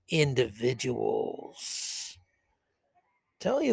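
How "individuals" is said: low, very drawn-out villain voice; slow, sinister lingering on the final sound; mock villain. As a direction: stretch the word with mock-sinister disdain. Mocks globalists' disdain for individuals.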